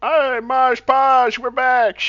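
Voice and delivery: high, slow, sing-song hello; cheery greeting. Acted. Thrawn calling to Ma at the door, high and slow sing-song. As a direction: call out home cheerily.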